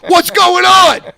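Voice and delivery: loud, high shouted comeback; angry retold shout. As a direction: shout back in disbelief. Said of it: "I'm like".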